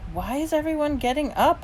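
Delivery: high-pitched, puzzled asking; puzzled question. A narrator's reading. Child asking parents during ovation, puzzled. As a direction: ask in a small high voice.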